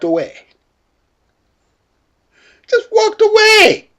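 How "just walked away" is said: laughing disbelief. Real, not acted. Laughing disbelief retelling the walkaway.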